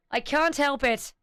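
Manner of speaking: ordinary talk, nothing marked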